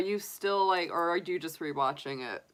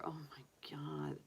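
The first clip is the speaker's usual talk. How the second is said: whisper; 3 semitones lower, 17 dB quieter than usual. Real, not acted. Whispered aside about bakeries.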